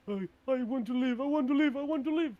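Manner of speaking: high, loud frantic cries; panicked pleading. Acted. Game master voices a doomed giant begging for life, panicked pleading.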